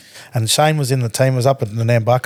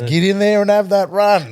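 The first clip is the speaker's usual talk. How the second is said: higher, mock demand; imitating a hard man; 6 semitones higher than usual. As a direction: shove him back in with words. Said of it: "He goes".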